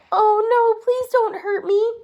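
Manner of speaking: high, loud, pleading little man; frightened cry. Acted. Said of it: "cried the little man".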